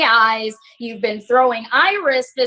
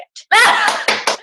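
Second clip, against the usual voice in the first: breathy mocking laugh, high; 9 semitones higher than usual. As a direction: laugh right in his face. Laughs at her own insult.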